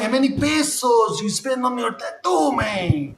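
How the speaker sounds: high, hammed-up Mexican accent. Streamer demanding tattoo cost in pesos, loud mock Mexican accent. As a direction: call it out as a cartoon Mexican. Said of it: "She's Mexican".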